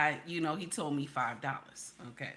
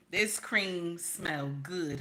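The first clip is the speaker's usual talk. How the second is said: savoring; much slower than usual. Real, not acted. Savoring scent.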